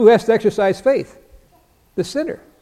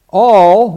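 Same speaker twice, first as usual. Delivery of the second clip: "All," punched out much louder; stress on ALL; 2 semitones higher, 9 dB louder than usual. Shown as a demonstration. Preacher stressing "All," against "some". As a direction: hit the word "All," hard, not some. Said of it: "not some".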